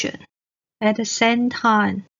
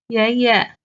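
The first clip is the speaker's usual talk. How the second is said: ordinary delivery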